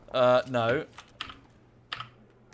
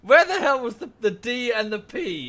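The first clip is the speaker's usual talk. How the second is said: high, loud exasperation at a garbled word; where the hell did the consonants go; exasperated outburst; 9 semitones higher, 6 dB louder, slower than usual. Real, not acted. Gamer shouts in disbelief at a teammate's garbled callout.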